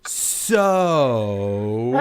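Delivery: long drawn-out, loud "so"; exaggerated, sustained filler word; kid imitating teacher. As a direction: stretch the word right out, mimicking a teacher's habit. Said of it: "the kid goes like this".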